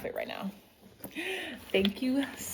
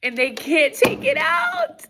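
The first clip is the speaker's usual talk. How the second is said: much higher gasp and squealed words, then louder; overjoyed squeal; 9 semitones higher, 4 dB louder than usual. Real, not acted. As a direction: shriek with joy. Gasping at best gift.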